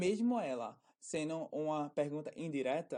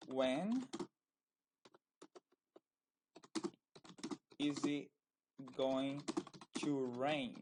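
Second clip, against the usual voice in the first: word-by-word dictation; 3 dB quieter, much slower than usual. Shown as a demonstration. Teacher giving students a question about rain, word-by-word dictation. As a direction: spread out with long pauses between chunks.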